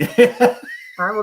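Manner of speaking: slow words through laughter; laughing. Real, not acted. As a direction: laugh while promising.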